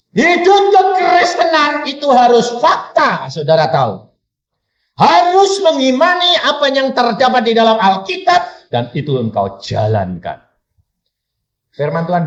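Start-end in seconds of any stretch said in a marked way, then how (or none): 0.1-3.3 high, loud, slow declaration; forceful, stretched preacher's cry; preaching shout. Real, not acted. Preacher proclaims point to congregation. 5.0-8.7 high, urgent pulpit call; raised preacher's voice; forceful preaching. Real, not acted. Preacher proclaims point to congregation.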